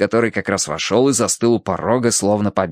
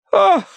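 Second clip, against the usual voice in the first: very high shocked "ah"; exhaled gasp; 4 dB louder than usual. A narrator's reading. Wife reads bad news and faints, high gasp. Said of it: "выдохнула миссис Меринг".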